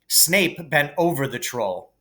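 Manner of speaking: plain reading voice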